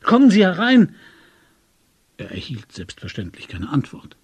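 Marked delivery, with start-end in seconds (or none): 0.0-1.0 loud, high shouted command; forceful raised cry; shouting. A narrator's reading. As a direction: yell through to someone outside. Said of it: "schrie Tegeljow".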